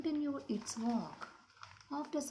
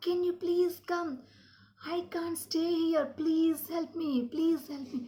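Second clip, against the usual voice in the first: high, loud acted plea for parents; storybook wail, pitched high and loud; crying scream for help; 8 semitones higher, 4 dB louder than usual. A narrator's reading. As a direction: cry out like a frightened little animal. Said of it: "and it started screaming".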